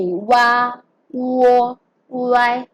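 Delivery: WA, WO, Y each opened with a w glide; teacher modelling "wa, wo, Y" with w onsets; w-sound demo. Shown as a demonstration. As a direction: sound out wa, wo, Y slowly separated, each starting on w. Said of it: "They'll be pronounced as if you are saying W".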